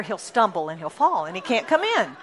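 ordinary talk

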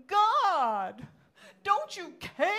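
loud, high anguished shout to heaven; theatrical, drawn-out desperate plea; dramatic cry. Acted. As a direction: cry out in hammed-up despair. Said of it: "that was dramatic".

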